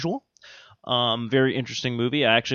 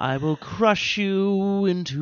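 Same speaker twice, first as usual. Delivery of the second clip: slow, high crooning villain; sing-song villain threat; [name] as Thanos; 5 semitones higher, much slower than usual. Pretend. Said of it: "He'd be like".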